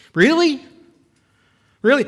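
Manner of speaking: high, disbelieving really; incredulous. Real, not acted. Preacher asking the congregation, high incredulous. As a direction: throw up your hands in disbelief.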